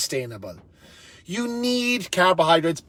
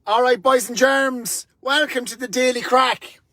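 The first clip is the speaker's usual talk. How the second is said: showman greeting; 7 semitones higher than usual. Acted. Show host greets viewers.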